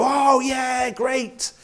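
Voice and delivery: high, gushing "Oh, yeah, great"; mock circus crowd. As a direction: play the thrill-hungry kid at the circus. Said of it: "they go".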